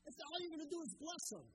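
a king losing his temper at his prophet; frustrated king. Acted. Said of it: "Balak the king is getting so frustrated".

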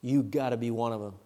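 low, quiet accusing voice; a bystander quietly accusing Peter. Retold or imitated. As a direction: murmur the accusation. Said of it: "he spoke with this dialect".

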